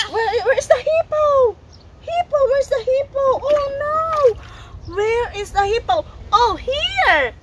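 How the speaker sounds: high-pitched pretend searching voice; playful searching. Parent searches for the hippo with child, high pretend concern. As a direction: hunt for it in a high, singsong play voice.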